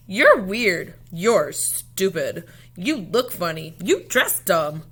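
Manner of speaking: loud, slowed jeering; rude taunts. Acted. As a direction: spit each insult out. Kids' rude insults.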